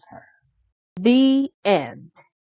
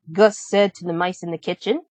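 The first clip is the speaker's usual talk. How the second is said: usual speaking voice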